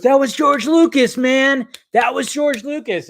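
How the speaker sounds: high-pitched mock-adamant quote; retold excited insistence. Retelling [name]'s excited defence of prequels.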